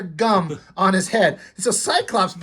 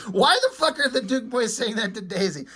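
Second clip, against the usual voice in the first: ordinary delivery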